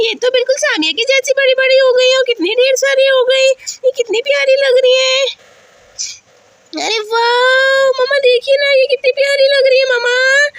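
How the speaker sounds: put-on high-pitched childish wonder; excited little girl. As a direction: gush like a thrilled child. Voices delighted child Aisha.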